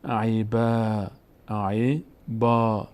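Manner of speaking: عیبا slowly, with the last vowel held; syllable-by-syllable reading. Shown as a demonstration. Teacher models the example word, slow long vowels. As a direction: stretch عیبا as e-baa.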